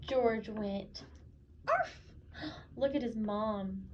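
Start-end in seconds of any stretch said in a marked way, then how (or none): 1.7-2.0 very high sharp yip; a joyful puppy bark; squeaky high arf. Acted. George finally barks.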